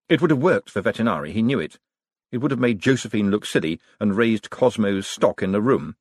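plain reading voice

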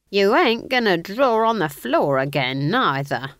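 slow, loud, high warning. A narrator's reading. Old witch forbids drawing on her floor, warningly. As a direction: warn firmly, stretching the words. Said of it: "warned Nanny Ogg".

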